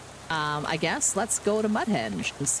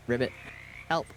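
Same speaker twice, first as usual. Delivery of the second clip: frog croak; 6 semitones lower, 4 dB quieter than usual. Acted. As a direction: croak then a small cry for help.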